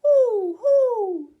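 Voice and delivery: high, loud owl hoots. Pretend. Owl character hooting its call for kids. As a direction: hoot it out like an owl. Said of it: "I stay up all night and I say".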